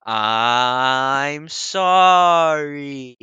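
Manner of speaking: put-on stretched, drawn-out childish apology; mock childish sorry. DM plays the child saying sorry, slowly stretched out. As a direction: drag it out like a sulky kid. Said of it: "like talking to a child".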